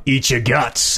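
ordinary delivery

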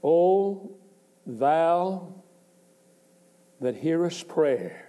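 slow, solemn reading of the verse; scripture reading. Acted. Preacher reading the psalm to the congregation, solemn. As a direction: lift the psalm words out slowly.

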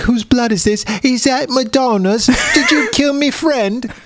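high, put-on falsetto woman demanding answers; mock accusing woman. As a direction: squeak it out like a shrill accuser. [name] voiced, accusing [name].

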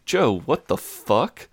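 high, drawn-out mock disbelief; high-pitched retold bafflement; imitating incredulous Megan. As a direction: put on a slow, rising "what on earth" reaction. Megan reacting to wrong foot.

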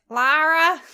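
hammed-up American "Lara"; overdone American accent. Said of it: "'cause he says it like".

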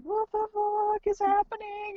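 very high, drawn-out fake cry; hammed-up squeaky distress; mock wailing. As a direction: imitate a panicked tearful wail. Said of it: "I'm crying, he's holding me".

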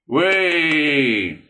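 high, loud, drawn-out whoop; long falling banquet cheer; crowd cheer. A narrator's reading. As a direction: cheer the speaker on.

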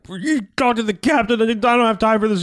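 high-pitched argumentative mock yell. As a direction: snap back in a high, imitated yell. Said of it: "starts the uncontrolled yelling".

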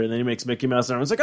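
ordinary talk, nothing marked

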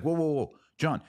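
ordinary talk, nothing marked

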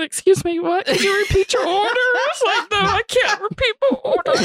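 high, breathless laughing fit; laughing hard. Real, not acted. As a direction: crack up, can barely speak. Laughing retold dialogue.

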